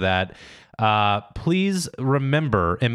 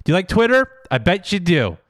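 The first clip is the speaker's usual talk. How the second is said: condescending; 9 semitones higher than usual. Pretend. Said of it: "So condescending".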